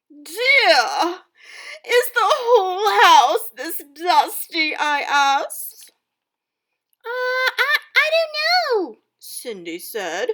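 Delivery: loud, lively storybook narration; dramatic story reading. Acted. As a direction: read it aloud like a theatrical stepmother. Teacher reads stepmother's story aloud.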